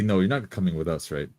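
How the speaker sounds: ordinary talk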